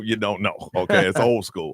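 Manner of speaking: normal talking voice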